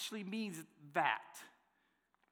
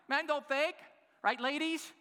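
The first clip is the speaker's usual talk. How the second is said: playful mock jab; 10 semitones higher, 4 dB louder than usual. Playfully voicing the women's thought.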